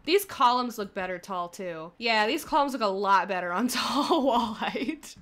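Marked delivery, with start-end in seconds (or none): 2.9-5.1 laughing. Real, not acted. Laughs while saying it.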